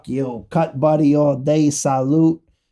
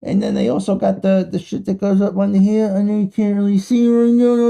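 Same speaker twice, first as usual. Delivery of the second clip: usual speaking voice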